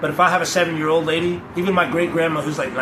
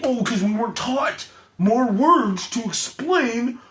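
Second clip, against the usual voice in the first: high, slow, mocking know-it-all voice; higher, slower sneering guru impression; mock preachy guru; 7 semitones higher, slower than usual. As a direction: parody a smug spiritual lecturer, drawn out. Mocking preachy ego-talkers.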